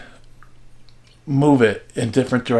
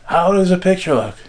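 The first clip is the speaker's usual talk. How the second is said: father's higher-pitched call down from the roof; retold yell; 7 semitones higher, 4 dB louder than usual. Father on the roof calls to mother, mock yell. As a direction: imitate calling down from a height. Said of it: "yell down".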